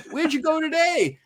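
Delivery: very high-pitched imitated host question; eager radio host. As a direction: call the question down the long-distance line. Acted DJ questioning faraway reporter.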